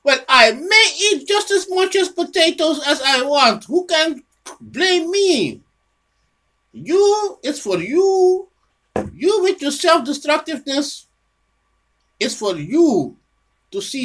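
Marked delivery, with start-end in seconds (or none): 0.0-5.6 higher, louder smug glutton voice, dragging out the last question; a hammed-up complacent overeater; mocking the self-indulgent eater. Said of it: "You say". 6.7-11.0 emphatic calling out. Real, not acted. Lecturing the self-destructive listener.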